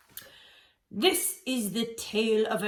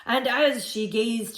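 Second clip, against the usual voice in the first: usual speaking voice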